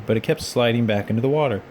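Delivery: usual speaking voice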